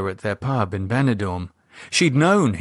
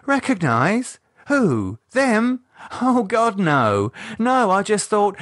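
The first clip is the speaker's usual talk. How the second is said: much higher, stammering, caught off guard; flustered denial; 11 semitones higher than usual. Acted. Michelle denying she knows the men, flustered and high. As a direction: deny it too quickly, rattled.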